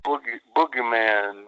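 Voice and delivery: low, slow, drawn-out bluesy murmur; real slow, real low. Shown as a demonstration. Wrestler demonstrating his surprise interview, real slow and low. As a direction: drop it down low and leave long pauses. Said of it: "I'm talking real slow, real low".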